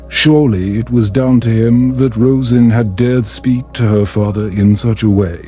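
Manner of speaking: usual speaking voice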